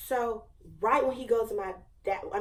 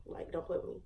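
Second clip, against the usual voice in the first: whispered aside; 2 semitones lower, 9 dB quieter than usual. Real, not acted. Aside about being a senior.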